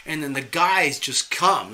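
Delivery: ordinary talk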